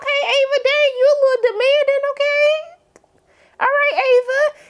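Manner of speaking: very high, squeaky mock indignation; playful teasing. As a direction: tease a bossy little kid in a high sing-song. Teasing a demanding child.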